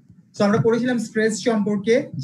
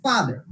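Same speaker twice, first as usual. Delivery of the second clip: Father, falling away after a stressed ফা; first-syllable stress; slower than usual. Shown as a demonstration. Teacher repeating the example for class, stress on ফা in father. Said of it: "ফা, এটার উপর আমরা stress দিব".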